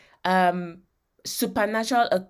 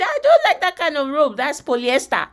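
picky objection, pitched up and loud; mock-fussy refusal of an offered rope; fussy complaining; 5 semitones higher, 6 dB louder than usual. Someone in quicksand refusing a rope, fussy complaining. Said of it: "they don't say".

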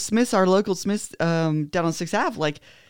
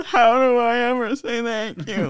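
put-on old guy voice; 3 semitones higher than usual. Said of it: "he's got that, like, old guy voice".

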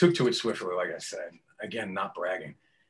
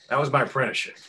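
ordinary delivery

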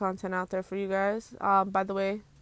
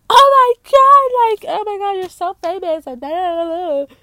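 much higher and louder, gushing and squealing; starstruck fan squeal; 10 semitones higher, 13 dB louder than usual. Retold or imitated. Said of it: "I've never put myself to be like".

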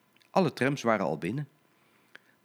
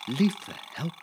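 startled thought; 6 semitones higher than usual. Acted. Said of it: "dacht Blauw Katrientje".

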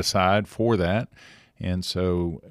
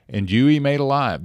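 scripture reading; 5 semitones higher, 4 dB louder than usual. Acted. I'll read the scriptures for us.

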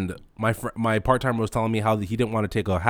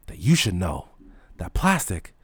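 normal talking voice

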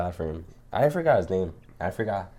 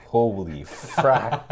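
very slow laughing holy frack; drawn out laughing; much slower than usual. Retold or imitated. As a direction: stretch it in amused disbelief. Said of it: "now I'm looking back like".

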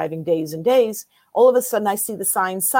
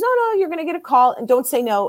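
insistent, higher and louder; urging her on, pitched up; excited psychic; 6 semitones higher, 4 dB louder than usual. Retold or imitated. Psychic urging her friend, mock insistent voice. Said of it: "She goes".

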